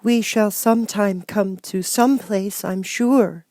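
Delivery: put-on high, slow young girl, hopeful. A narrator's reading. Girl reassuring her tired companions, high young voice. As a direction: lift it up into a little girl's voice. Young girl's line in story.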